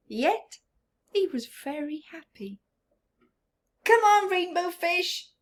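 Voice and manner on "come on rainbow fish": higher, louder friendly call. Acted. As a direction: call across the water. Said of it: "they called".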